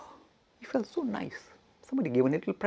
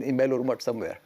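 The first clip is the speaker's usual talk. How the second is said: ordinary delivery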